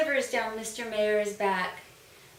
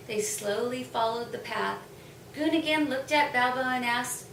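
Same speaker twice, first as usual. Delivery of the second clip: usual speaking voice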